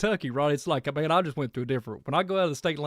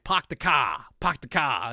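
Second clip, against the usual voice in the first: put-on light Rhode Island accent, r dropped in park and car; 4 dB louder, slower than usual. Mimicking northerners in Rhode Island, light Rhode Island accent. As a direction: imitate a Rhode Islander saying park the car as pahk the cah. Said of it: "people from up north, like in Dan's area ... in Rhode Island".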